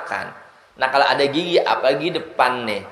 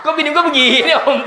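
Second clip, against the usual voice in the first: high, loud shocked cry; shocked husband; 12 semitones higher, 5 dB louder than usual. Retold or imitated. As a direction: gasp it out as a stunned groom. Groom shocked on wedding night.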